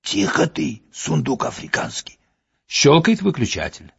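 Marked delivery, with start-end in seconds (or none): 0.0-2.2 hushed hissing whisper. Acted. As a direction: hiss it through the teeth, barely voiced. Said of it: "Шипящий голос произносит".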